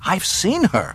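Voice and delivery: high, pleading persistence; desperate insistence. A narrator's reading. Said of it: "Luke persisted desperately".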